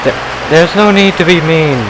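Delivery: whiny hurt complaint; hurt whine. Acted. Hurt by kid's insults.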